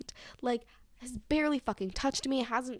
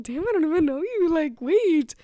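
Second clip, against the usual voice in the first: flustered surprise; 7 semitones higher, 6 dB louder than usual. Retold or imitated. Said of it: "I was just like".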